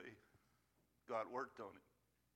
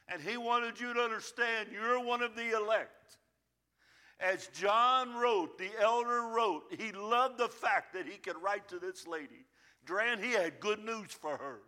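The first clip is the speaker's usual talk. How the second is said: high, loud preaching, slowing on "As John wrote"; full-force preaching; 5 semitones higher, 8 dB louder than usual. Acted. Pastor telling of John's letter, preaching loud and high. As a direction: proclaim the good news.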